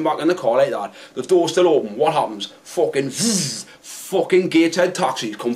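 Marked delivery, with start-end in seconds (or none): none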